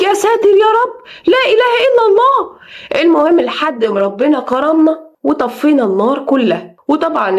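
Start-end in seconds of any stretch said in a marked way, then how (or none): 0.0-2.6 shocked exclamation. Retold or imitated. Neighbours staring at the burning house, crying out in shock. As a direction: gasp it out as neighbours watching a fire. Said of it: "يقولك".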